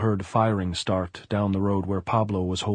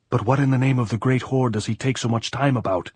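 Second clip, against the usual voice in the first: higher, raised voice of impatience; exasperated demand; 4 semitones higher, 4 dB louder than usual. A narrator's reading. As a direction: demand an answer across the distance. Pilar shouts back, exasperated.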